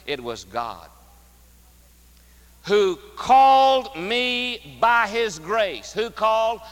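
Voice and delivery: slow, loud, ringing pulpit voice; forceful preaching. Acted. Preacher proclaiming scripture to the congregation, full force. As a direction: preach it out to the back pews.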